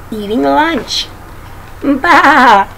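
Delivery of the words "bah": loud drawn-out lamb bleat; storybook lamb's baa. A narrator's reading. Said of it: "said little lamb".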